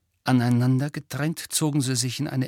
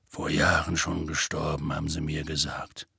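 lower, heavy giant voice, sorrowful; gruff gentle giant telling bad news; sad half-giant; 7 semitones lower, 4 dB quieter than usual. A narrator's reading. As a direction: speak like big sad Hagrid. Hagrid voiced.

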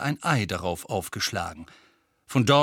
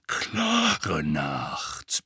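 low grumble; grumbling Moody; 8 semitones lower than usual. A narrator's reading. Moody scans the night sky, low grumbling. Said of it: "brummte Moody".

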